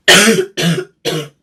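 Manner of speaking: a loud, hacking coughing fit; real coughing fit. Real, not acted. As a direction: cough hard through a bad cold. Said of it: "I'm crying from that".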